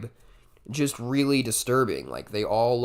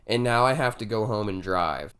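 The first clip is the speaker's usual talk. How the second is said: usual speaking voice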